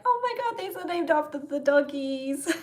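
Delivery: high, delighted squeal of discovery; excited gush. Pretend. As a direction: gasp it out like a thrilled fan spotting the dogs' names. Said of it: "then I can be like".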